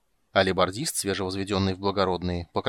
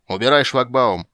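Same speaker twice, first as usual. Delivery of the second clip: loud barked command; barked order; 4 semitones higher, 9 dB louder than usual. Acted. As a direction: bark it at the guards. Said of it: "рявкнул на алебардистов".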